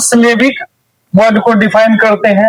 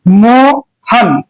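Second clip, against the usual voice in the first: Mohan in two drawn-out pieces, मो, pause, हन; slow, syllable by syllable; much slower than usual. Shown as a demonstration. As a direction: hold मो, stop, then let हन fall. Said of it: "धीरे से बोलने का". (मोहन धीरे से, मो और हन के बीच रुककर)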